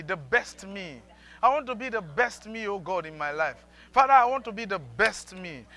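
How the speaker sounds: loud, pleading prayer; fervent prayer. Real, not acted. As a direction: cry out to God with the people.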